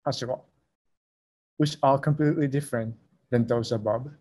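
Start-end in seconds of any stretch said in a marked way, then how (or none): 0.1-0.4 Hashiwa said softly in the Osaka pitch pattern; Osaka pitch accent demo. Shown as a demonstration. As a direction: model Hashiwa as Osaka speakers say it. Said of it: "The Osaka dialect speakers pronounce them".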